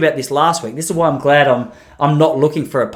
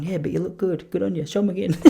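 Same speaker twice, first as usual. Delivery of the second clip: soft, high little inner voice; hushed, higher-pitched egging-on; encouraging inner voice; 5 semitones higher, 8 dB quieter than usual. Retold or imitated. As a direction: coax quietly, like a cheeky inner tempter. Voicing brain's encouraging other part.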